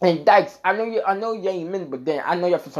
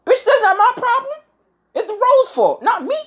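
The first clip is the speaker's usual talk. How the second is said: sharp, much higher and louder angry rant; heated outburst; 5 dB louder than usual. Acted. Ranting at his partner who is too tired after the rose, angry high outburst. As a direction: blow up at her, pitch flying high.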